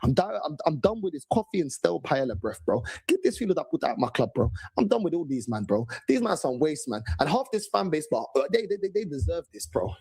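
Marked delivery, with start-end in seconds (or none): none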